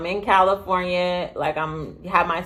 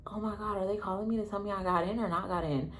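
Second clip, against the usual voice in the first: anxious hush; 13 dB quieter, faster than usual. Retold or imitated. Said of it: "And I'm like".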